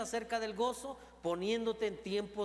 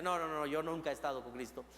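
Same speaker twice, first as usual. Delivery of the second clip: lower, quick panicky denial as Peter; Pedro denying; 6 semitones lower, faster than usual. Acted. Peter denying Christ to the crowd, hurried low denial. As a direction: brush it off fast and low, scared to be caught. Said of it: "Pedro dice".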